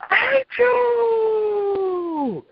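drawn-out shrieking cry, very high; screaming demon. Retold or imitated. Demon bursting out of his mouth, long high shriek. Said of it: "hollered so loud".

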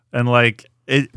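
usual speaking voice

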